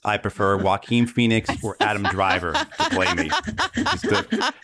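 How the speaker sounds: chuckling through a self-deprecating joke; laughing joke. Real, not acted. Jokes about casting himself.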